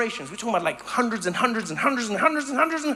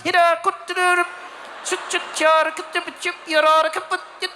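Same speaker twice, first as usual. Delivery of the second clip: higher, tight-mouthed fake Swedish gibberish; mock Swedish; 6 semitones higher than usual. Swede who can't mess around in winter, curt mock Swedish. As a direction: chatter curt mock Swedish through a barely open mouth. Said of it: "You just gotta get 'em tucked".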